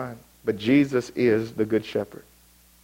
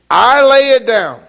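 much higher, louder and slow; preaching shout; 11 semitones higher, 8 dB louder, slower than usual. Acted. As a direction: proclaim it at full force from the pulpit. Preacher voices Jesus, climax.

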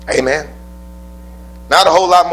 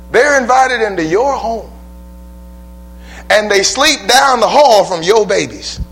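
preaching at full force; 8 semitones higher than usual. Acted. Preacher pressing the congregation about welcoming strangers, full-force preaching.

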